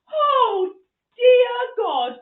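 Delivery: high, loud cry of despair; drawn-out, shouted dismay; mortified wail. Retold or imitated. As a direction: wail it out to the heavens. Mortified reaction, acted.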